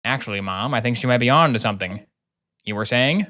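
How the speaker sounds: usual speaking voice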